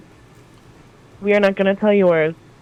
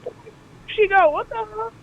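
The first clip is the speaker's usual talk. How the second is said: surprised; 11 semitones higher, 3 dB quieter than usual. Real, not acted. Lost track of character.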